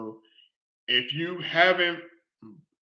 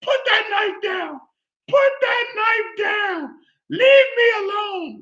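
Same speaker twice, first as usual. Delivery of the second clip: high, slow crying shout; frantic yelling; slower than usual. Retold or imitated. Woman ordering the killer to drop the knife, crying shout. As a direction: yell at him in desperate panic. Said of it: "She's like".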